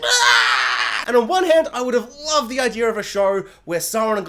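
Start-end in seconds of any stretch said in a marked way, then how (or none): 0.0-0.7 shocked scream. Retold or imitated. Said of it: "she'd be like".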